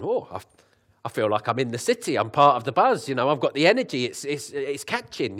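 loud, high and buzzing with energy; excited. Retold or imitated. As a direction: gush like the city's energy grabbed you. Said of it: "I was thinking".

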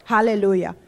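usual speaking voice

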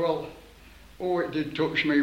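usual speaking voice

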